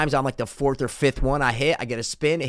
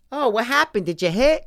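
put-on high curious old lady voice; 10 semitones higher than usual. Old lady asking if he hit, high voice. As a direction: ask like a nosy old lady.

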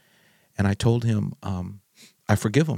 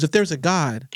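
higher, lifted voice of a raging father; retold raging; 6 semitones higher than usual. Son retelling his father's raging questions about God, mildly raised. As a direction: relay the father's fury about burning babies. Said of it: "he was just raging".

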